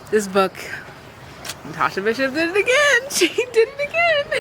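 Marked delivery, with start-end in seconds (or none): 1.7-4.4 much higher, fast, laughing delight; gushing praise. Real, not acted. Reader raves to viewers about the author, gushing. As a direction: rave about the author, bursting with glee.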